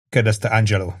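ordinary delivery

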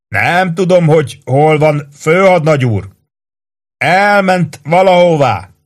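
high, slow relayed shout. A narrator's reading. Chief shouting the message on to the lieutenant. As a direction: pass the message on at full voice.

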